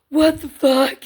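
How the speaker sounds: high, loud, stunned outburst; mock shock. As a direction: re-enact being floored by a big surprise. Retelling shock at big grid amounts.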